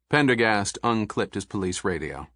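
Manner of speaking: ordinary delivery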